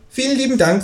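high-pitched kangaroo thanks. A narrator's reading. Kangaroo thanks neighbour for eggs, high voice. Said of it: "sagt das Känguru".

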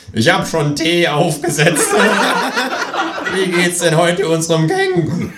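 high, loud, chuckling host voice; jolly psychiatrist. Acted. Psychiatrist welcoming Herr Kling, high and jolly. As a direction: greet him like an overly cheerful doctor.

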